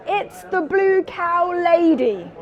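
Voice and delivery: ordinary talk, nothing marked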